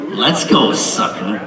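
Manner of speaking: evil laugh then sneer; evil daughter. Retold or imitated. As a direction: gloat like a villain. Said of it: "And she said".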